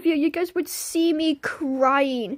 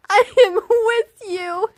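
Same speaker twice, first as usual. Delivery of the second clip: laughing; 5 semitones higher, 4 dB louder than usual. Real, not acted. Laughing at chat.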